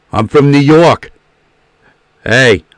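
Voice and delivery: brash New York tough guy; New York accent. Shown as a demonstration. Showing off his old party trick, loud New York accent. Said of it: "let's see if I can still do it".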